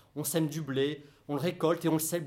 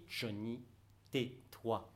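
hushed; 5 semitones lower, 10 dB quieter, much slower than usual. Acted. Inner voice hushing him.

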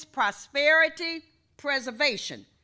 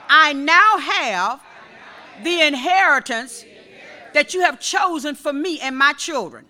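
fervent prayer; 2 semitones higher, 7 dB louder than usual. Real, not acted. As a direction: pray it out to the whole church. Praying aloud in church.

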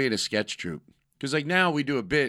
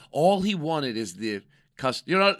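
ordinary delivery

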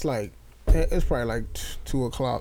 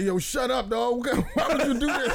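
higher, laughing disbelief at the post; mock outraged commenters; 8 semitones higher than usual. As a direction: imitate the commenters, cracking up. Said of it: "mad people was like".

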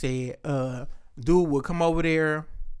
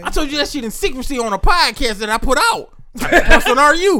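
higher, louder, laughing indignation; laughing outrage; 7 semitones higher, 6 dB louder than usual. Real, not acted. Host scolding a friend for revealing his secret, laughing. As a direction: scold him while cracking up.

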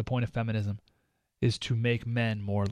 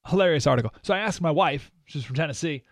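ordinary delivery

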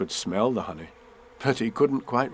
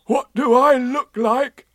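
high, loud, drawn-out call; slow high-pitched shouted question; Winnie the Pooh; 12 semitones higher, 6 dB louder, slower than usual. A narrator's reading. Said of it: "shouted Winnie the Pooh down to you".

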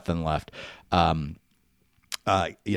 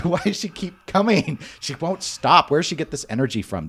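exasperated; 6 semitones higher than usual. Retold or imitated. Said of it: "I'm like".

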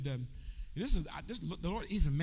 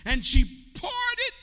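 louder and louder, to a shout; preaching at full force; 3 semitones higher, 7 dB louder than usual. Acted. Preacher rousing the congregation, building to a loud shout.

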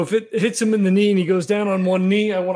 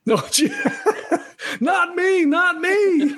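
laughing; 12 semitones higher, slower than usual. Real, not acted. Joking denial while laughing.

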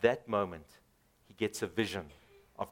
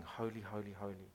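hushed adoring; 6 semitones lower, 14 dB quieter than usual. Retold or imitated. Preacher voicing seraphim, hushed and adoring. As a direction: drop to a near-hush, much softer. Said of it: "They continue to sing".

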